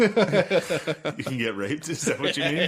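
slow, laughing disbelief. Real, not acted. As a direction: ask it through laughter. Laughing at a crude joke.

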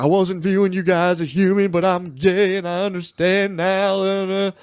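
high, drawn-out Southern caricature; hammed-up confession, stretching out the last words.

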